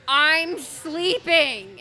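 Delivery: loud, heavily stretched protest; indignant retort. Retold or imitated. Woman retells answering the partner who woke her, indignant. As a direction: drag the words out, put out and loud.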